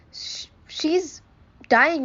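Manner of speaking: breathless whisper of dread, then the word breaks out; frozen with fear, barely getting the words out; shocked whisper. A narrator's reading. Mother watching her daughter collapse, a horrified whisper.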